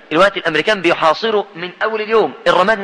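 normal talking voice

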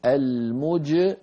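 "مجري" ببطء شديد وجيم مقلقلة قبل الكسرة; أنهِ الجيم تمامًا ثم الكسرة; قلقلة الجيم. Shown as a demonstration. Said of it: "ثم بعد ذلك نخفض الفك". ("المجري" بجيم ساكنة مقلقلة واضحة)

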